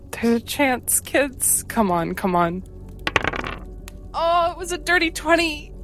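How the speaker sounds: ordinary talk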